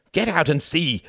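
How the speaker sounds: high, loud, angry command; forceful order. A narrator's reading. Wendy shoving Reginald towards the cab door, forceful. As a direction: push him with the words. Wendy pushes him violently.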